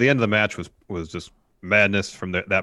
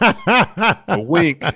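laughing; 10 semitones higher, 8 dB louder than usual. Real, not acted. Laughing at wrestler's wink.

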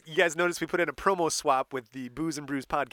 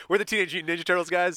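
fast; 3 semitones higher, 3 dB louder, faster than usual. Pretend. Mock team announcement.

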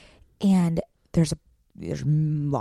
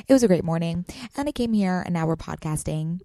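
ordinary talk

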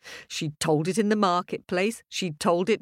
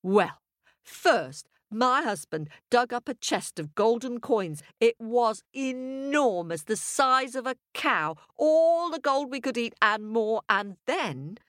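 proud wife spinning a tale, higher; boastful, higher-pitched storytelling; glowing with pride; 6 semitones higher than usual. Acted. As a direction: relish every wondrous detail. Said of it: "glowing with pride".

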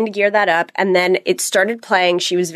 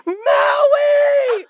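sudden scream. Retold or imitated. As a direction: snap and scream the name at a kid. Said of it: "I just screamed".

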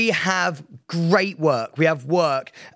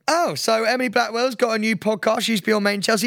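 higher-pitched breezy dismissal; throwaway radio read; 8 semitones higher than usual. Retold or imitated. Radio host brushing off a rival podcast, breezy higher read. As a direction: rattle it off in a lifted presenter voice. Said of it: "I basically go".

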